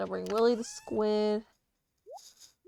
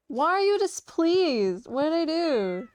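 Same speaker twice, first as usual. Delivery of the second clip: mock hurt; 5 semitones higher, 4 dB louder, slower than usual. Speaking to a game character.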